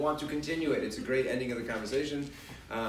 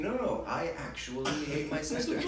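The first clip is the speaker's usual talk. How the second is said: put-on child's stubborn thought. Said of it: "they're thinking".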